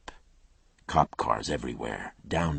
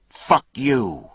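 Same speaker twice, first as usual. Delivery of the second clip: loud, higher furious scream; 9 semitones higher, 9 dB louder than usual. Acted. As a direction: scream it with hate. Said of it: "Coop screamed it".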